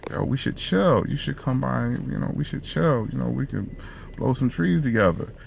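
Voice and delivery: high, softened flirtatious come-on; flirty. Acted. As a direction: coax her sweetly, acting out the pickup. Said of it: "you be real flirty".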